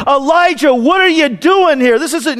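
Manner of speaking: high, forceful scolding; mock angry shout. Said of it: "shout in anger".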